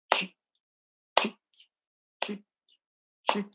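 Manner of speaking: short dry ticks spaced a second apart; tiny isolated "tic" on each beat; clock tick. Shown as a demonstration. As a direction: imitate a ticking metronome, evenly spaced. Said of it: "vamos supor que isso aqui é um segundo".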